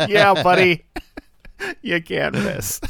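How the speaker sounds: mock him while cracking up; laughing sarcasm. Real, not acted. Critic mocking the hero's boast, laughing sarcastic jeer.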